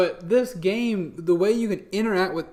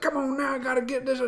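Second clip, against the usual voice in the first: coaxing a horse; 8 semitones higher, faster than usual. Retold or imitated. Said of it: "He's like".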